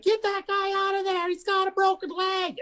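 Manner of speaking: mock high squeaky shout about the leg; high voiced buddy. Buddy yelling during pile-up.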